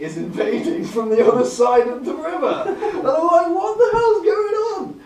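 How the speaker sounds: high, loud, slow laughing shriek; laughing disbelief. Retold or imitated. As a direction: laugh it out, pitch soaring. Retelling panic, laughing.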